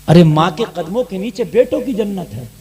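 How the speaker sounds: ordinary delivery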